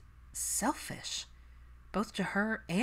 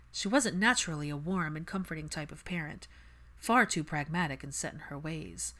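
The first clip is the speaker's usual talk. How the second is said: plain reading voice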